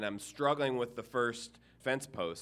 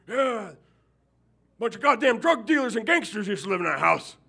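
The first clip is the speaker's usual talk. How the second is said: high, loud gossiping holler; loud neighbor; 10 semitones higher, 8 dB louder than usual. Retold or imitated. Neighbor yelling at the minister about the old tenants, loud and high. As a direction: bark the news across the yard. Neighbor's reply, still shouting.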